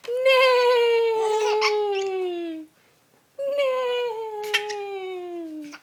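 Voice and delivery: high, loud whinnying neigh; whinny it high and long like a horse. Pretend. Grandma neighing high for the baby.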